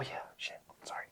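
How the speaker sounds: hushed whisper under the breath. Real, not acted. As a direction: whisper it to yourself. Sudden spill while cleaning shoes.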